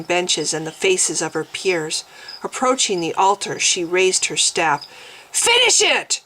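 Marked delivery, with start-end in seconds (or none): 5.3-6.2 high, loud commanding scream. A narrator's reading. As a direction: order them to finish. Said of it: "she screamed at her attendant first".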